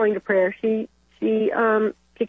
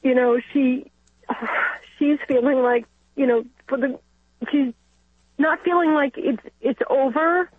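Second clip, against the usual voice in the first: higher-pitched, halting, close to tears; emotional, choked up; 5 semitones higher than usual. Real, not acted. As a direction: fight back real tears while explaining. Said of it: "I'm getting emotional".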